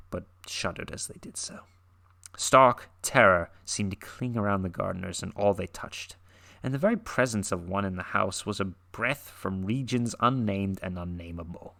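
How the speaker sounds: ordinary delivery, nothing marked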